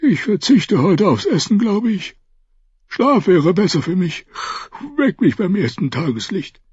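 put-on tired, yawning old lord; sleepy. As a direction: say it drowsily after a yawn. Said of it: "verbarg ein Gähnen".

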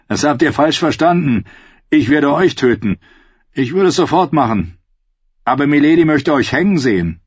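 taunting outlaw voice; menacing Hound. Acted. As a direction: threaten her with relish.

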